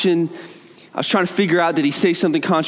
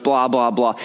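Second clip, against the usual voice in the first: usual speaking voice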